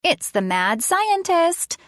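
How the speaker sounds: high, drawn-out playful warning; slow mock-spooky little girl voice; funny child voice. A narrator's reading. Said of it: "she said in a funny voice".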